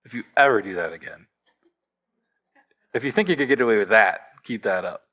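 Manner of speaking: low, menacing warning; parental threat. Retold or imitated. Father demonstrates threatening his kids, low and menacing. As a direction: growl it like a fed-up parent. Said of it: "we correct with the threats".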